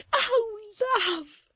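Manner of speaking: high, loud tearful cry. A narrator's reading. Maid crying out to Florence in high, loud sobs. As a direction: Susan bursts into tears on her mistress's neck. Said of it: "cried Susan".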